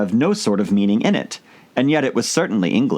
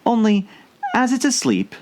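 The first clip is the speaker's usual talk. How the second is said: put-on higher young girl voice, musing to herself; a girl's higher musing voice; Alice musing; 14 semitones higher than usual. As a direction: think it aloud as a curious girl. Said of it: "thought Alice".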